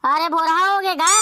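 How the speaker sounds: usual speaking voice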